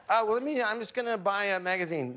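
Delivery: high-pitched, mock-casual excuse; awkward excuse. Retold awkward excuse to avoid elevator.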